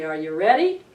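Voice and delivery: ordinary delivery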